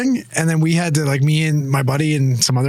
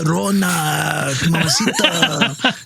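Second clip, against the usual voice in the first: slow, hammed-up Mexican Spanish accent; mock Mexican accent; much slower than usual. Mocking how a friend heard it, slow mock Mexican accent. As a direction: drag out the Spanish words in a jokey Mexican accent. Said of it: "Dan is like".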